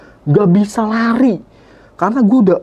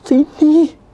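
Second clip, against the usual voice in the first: high, loud call; high shaky shout to come here; retold shout; 9 semitones higher, 5 dB louder than usual. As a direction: yell it out, shaking. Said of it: "gua teriak".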